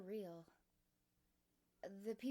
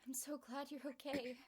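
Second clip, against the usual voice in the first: crying; 6 semitones higher than usual. Acted. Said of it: "crying onto my shoulder".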